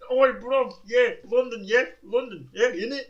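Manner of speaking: hammed-up London lad yelling innit; imitating a rowdy Londoner. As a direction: bellow it like a rowdy roadman. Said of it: "just goes again".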